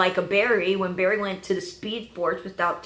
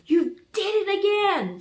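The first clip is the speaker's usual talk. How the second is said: imagined upset partner, pitched up; Felicity; 8 semitones higher than usual. Acted. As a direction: whine it as an exasperated accusation. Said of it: "Felicity's gonna be like".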